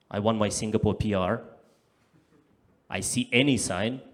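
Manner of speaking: normal talking voice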